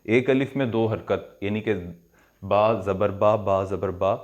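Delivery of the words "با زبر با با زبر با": 'با زبر با' with the final a held for two beats; two-beat long a. Shown as a demonstration. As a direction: stretch the a in 'با' to two counts. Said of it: "اس کو دو second دے دیے".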